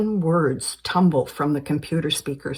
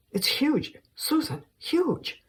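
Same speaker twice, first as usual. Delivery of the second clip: excited; 10 semitones higher, 4 dB quieter, slower than usual. Acted. Martin hyping the drug's potential.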